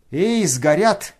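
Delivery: loud high shout of warning. A narrator's reading. Nastasya shouting at Ganya to grab the burning money. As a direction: call out sharply across the room. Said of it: "кричала ему Настасья Филипповна".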